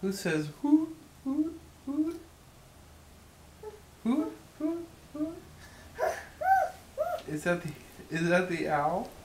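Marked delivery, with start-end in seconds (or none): 1.2-5.4 high, rising owl hoots; high hooting for the baby. Pretend. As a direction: hoot up high like a playful owl. Parent imitating owl for toddler.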